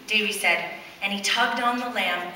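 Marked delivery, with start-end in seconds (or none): none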